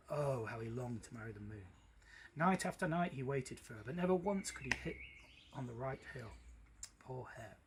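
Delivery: plain reading voice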